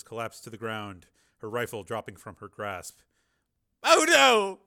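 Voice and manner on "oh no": high, loud cry of dismay; alarmed cry. A narrator's reading. Man seeing his companion fall, alarmed shout. Alarm as someone falls in gunfire.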